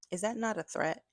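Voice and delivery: ordinary delivery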